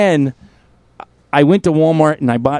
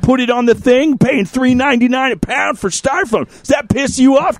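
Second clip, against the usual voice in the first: higher, pumped-up vlogger rant; ranting video host; 7 semitones higher than usual. Retold or imitated. Host reenacting his angry video to followers, higher rant. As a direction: play the outraged online crusader. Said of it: "I get the camera and I'm like".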